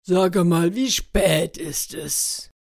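slow, drawn-out wolf just woken up; sleepy wolf. Acted. Wolf asks his parrot the time, slowly and groggily.

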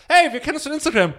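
high, loud, eager stranger; excited greeting. Retold or imitated. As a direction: gush like a fan.

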